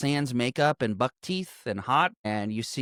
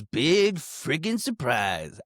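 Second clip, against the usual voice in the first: slow sarcasm; 2 semitones higher, much slower than usual. Retold or imitated. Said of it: "It goes".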